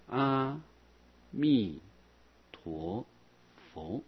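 each syllable separated by pauses, fading at the end. Shown as a demonstration. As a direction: space out 阿 弥 陀 佛 one at a time. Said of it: "或是念阿弥陀佛四个字也可以". (一字一顿念阿弥陀佛)

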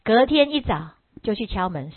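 usual speaking voice

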